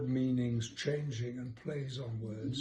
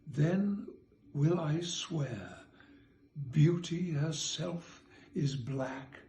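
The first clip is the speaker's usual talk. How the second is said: measured poetry reading, louder; reciting a sonnet; 2 semitones higher, 6 dB louder, slower than usual. Acted. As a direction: read the sonnet with care. Reads sonnet aloud.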